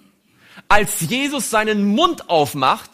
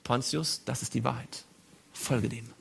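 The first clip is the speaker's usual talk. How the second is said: much softer, lower voice of conscience; inner voice; 7 semitones lower, 12 dB quieter than usual. Acted. The conscience speaks softly to Pilate's heart. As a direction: murmur it like a voice inside the heart. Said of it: "eine Stimme an deinem Herzen".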